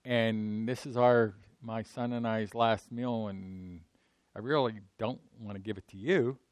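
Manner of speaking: hushed poor widow; widow's plea. Acted. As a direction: say it barely above a breath, ashamed of the little she has. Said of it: "when she said".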